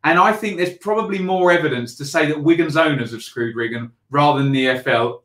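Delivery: ordinary talk, nothing marked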